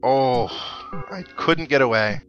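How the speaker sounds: usual speaking voice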